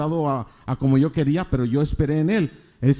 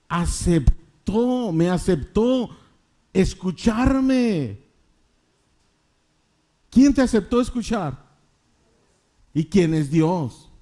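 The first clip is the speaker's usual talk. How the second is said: emphatic preaching; 7 semitones higher than usual. Real, not acted. Preacher challenging the congregation to describe God, emphatic high preaching.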